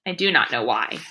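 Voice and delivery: low flat aside; deadpan. A narrator's reading. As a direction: drop it dry and puzzled.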